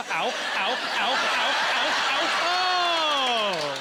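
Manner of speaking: mock yelps building to a loud cry; acted pain. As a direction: play repeated ouches swelling into a long wail. Blind person reading hot braille sign.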